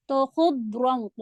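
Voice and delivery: خضرًا with a heavy خ, ض and ر, drawn slow; heavy letters. Shown as a demonstration. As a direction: keep خضرًا full and heavy throughout. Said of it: "بہت heavy". (خضرًا کو بھاری پڑھیں)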